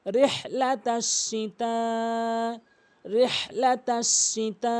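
strong throaty h in রিহ, long final সিতা; model recitation. Shown as a demonstration. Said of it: "রিহ বলতে হবে".

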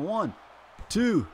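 rising loud count; excited pin count. Real, not acted. Commentator counting the pin, rising excitement.

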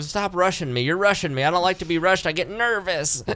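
high-pitched mock flustered good old boy; Jeff Sessions. A host mocking the senator's testimony under questioning, flustered high-pitched imitation. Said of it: "he's a slow, good old boy".

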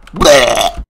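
loud bleating yelp; short loud squawk; startled blurt. Real, not acted. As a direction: blurt a noise in fright. Sudden scare in game.

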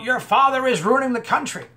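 mock scream. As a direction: belt it out like the angry passenger. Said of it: "He screams out".

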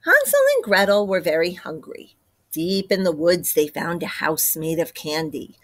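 plain reading voice

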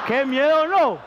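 high, loud mock-frightened cry; mock fright. Comedian mocking scared protagonists, high and loud. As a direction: shriek it like you're faking terror.

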